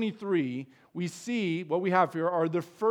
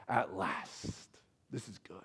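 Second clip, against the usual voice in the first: hushed awe; 12 semitones lower, 12 dB quieter, slower than usual. Retold or imitated. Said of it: "Instead, he says".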